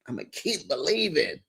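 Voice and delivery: a hushed echo of the vow. Shown as a demonstration. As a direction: repeat it as a quiet private hope. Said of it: "said loud first, then this".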